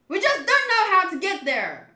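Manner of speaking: acted exasperated yell; exasperated shout. Acted exasperated manga outburst.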